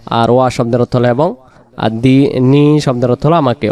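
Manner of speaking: ordinary talk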